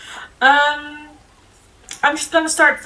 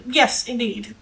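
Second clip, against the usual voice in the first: whispered, dry reply; a cool whispered yes; mock whisper. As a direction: breathe it out with no voice. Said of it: "I just replied with".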